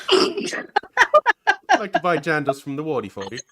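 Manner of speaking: loud, slow mock Kiwi accent slipping British; British-sounding Kiwi attempt. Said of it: "That's more British".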